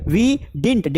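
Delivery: didn't as one syllable DINT; short-form pronunciation. Shown as a demonstration. Teacher repeats negative for class, didn't said DINT. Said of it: "Did not को shortcut में".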